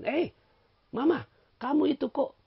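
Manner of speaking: high, reproachful voice of a nagging wife; hammed-up wife chiding her husband; mock scolding wife. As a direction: scold like a fussy wife at home.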